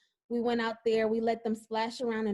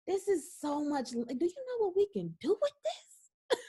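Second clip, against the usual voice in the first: amazed; 6 semitones higher, 3 dB quieter than usual. Retold or imitated. Excited at all that land.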